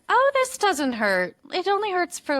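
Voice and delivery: mock doctor. Physician reassuring a patient, breezy and dismissive. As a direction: play the breezy doctor brushing off pain.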